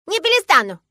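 put-on high, loud childish refusal; defiant little girl. A narrator's reading. Girl refusing father's order, defiant. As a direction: refuse point-blank like a stubborn child.

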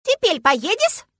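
put-on high, loud, slow childish question; demanding little girl. A narrator's reading. Girl pushing father to go, demanding. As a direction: press him word by word.

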